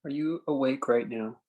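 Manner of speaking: slow, spacious meditation guide with long pauses; calm phrases left hanging in silence; guided meditation. Acted. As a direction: ease them into stillness, unhurried.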